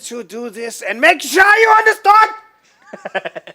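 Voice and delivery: German accent building to a high angry shout; slow German menace, exploding at the end. Shown as a demonstration. Host shows how German accents end, angry mock shout. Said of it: "angry right at the end".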